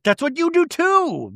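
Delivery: high-pitched squabbling retort; mock comeback. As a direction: throw it back in a hammed-up high voice. Imitating Tamra's childish comeback.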